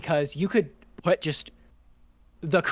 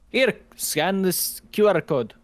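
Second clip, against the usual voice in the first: slow, exaggerated Japanese accent; hammy Japanese accent impression; bad Japanese accent; 4 semitones higher, 4 dB louder, slower than usual. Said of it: "that was my horrible, worst Japanese accent ever".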